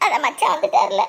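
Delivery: words breaking into sobs; crying through the words; tearful. Acted. Woman weeping to grandmother about her husband, tearful.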